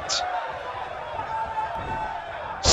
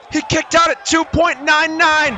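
wrestling commentator; 8 semitones higher than usual. Acted. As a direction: call the kickout like ringside. Play-by-play of a kickout.

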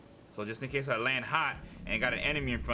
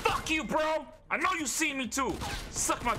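shout. Real, not acted. Yelling at another player.

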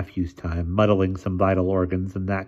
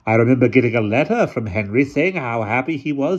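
put-on old lady voice; 5 semitones higher, 4 dB louder than usual. A narrator's reading. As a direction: speak like a commanding elderly aunt.

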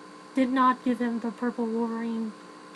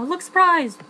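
higher, louder called-out prompt; director's cue; 6 semitones higher, 8 dB louder, slower than usual. Acted. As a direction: call it out like a director. Voicing a director's cues to actress.

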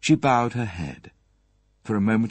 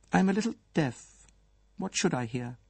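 higher, brisk lady's voice feigning innocence; Mrs Folliot's voice; 9 semitones higher, faster than usual. Acted. Mrs Folliot dodging Poirot's question, evasive and quick. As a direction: play deaf, quick and evasive.

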